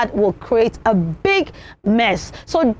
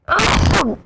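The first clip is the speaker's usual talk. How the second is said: higher-pitched pretend sneeze; fake sneeze; 6 semitones higher than usual. Shown as a demonstration. Teacher shows someone sneezing at table, fake sneeze. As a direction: act out a sudden sneeze. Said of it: "When someone sneezes like that".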